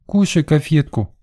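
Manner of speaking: put-on high-pitched toddler's offer; little girl. A narrator's reading. Tot offers boy a caramel, high and childish. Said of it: "Малышка склонила голову набок".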